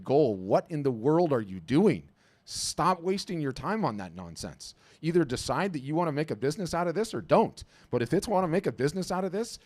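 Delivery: rally them like a coach at half time; fired-up pep talk. Real, not acted. Business coach urging artists to stop wasting time, fired up.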